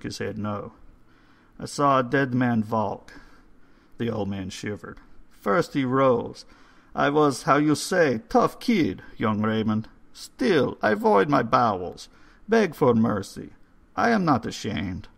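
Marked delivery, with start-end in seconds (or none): 1.6-3.1 put-on higher, louder old foreign voice; old man telling a dark tale. 5.3-15.1 put-on higher, louder old foreign voice; old man telling a dark tale. Old man confesses his fear.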